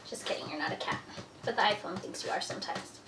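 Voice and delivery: soft whisper to a pet. Real, not acted. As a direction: breathe it to the cat. Aside to a pet.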